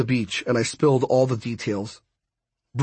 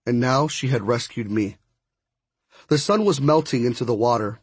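plain reading voice

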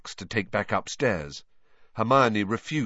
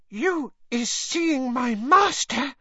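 high, slow, breathless elf question; Winky asks Hermione, high and breathless; breathless house-elf; 4 dB louder, slower than usual. Acted. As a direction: gasp it out, hardly daring to hope. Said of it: "said Winky breathlessly".

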